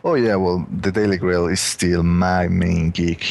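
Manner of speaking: ordinary delivery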